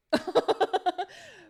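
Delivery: loud, high burst of laughing; overjoyed laughter. Real, not acted. Streamer overjoyed by the game, laughing loudly.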